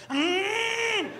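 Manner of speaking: high strangled yelp; mock muffled cry. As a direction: yelp as you're shaken. Cow shaking him.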